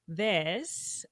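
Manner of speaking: usual speaking voice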